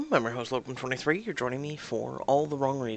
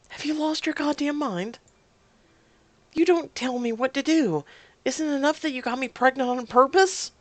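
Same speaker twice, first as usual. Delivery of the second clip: high-pitched, raised-voice tirade; furious; 10 semitones higher, 6 dB louder than usual. Acted. Woman berates partner for getting her pregnant, furious. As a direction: lash out, voice pitched right up. Acted quarrel after slapping him.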